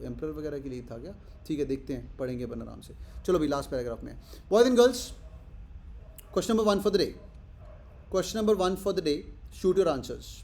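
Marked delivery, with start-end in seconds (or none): 4.5-10.3 raised, higher announcing voice; calling out to class. Real, not acted. Teacher opens question one to the class, calling out. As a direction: call the class to attention.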